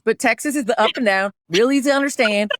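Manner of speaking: put-on Texas accent; direct Texas accent. Shown as a demonstration. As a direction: talk plain, easy Texan. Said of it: "that's, like, pretty direct Texas".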